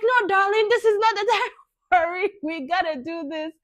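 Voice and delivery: high, loud, firm urging; urgent insistence. Retold or imitated. Doctor urging the labouring mother on, loud and insistent.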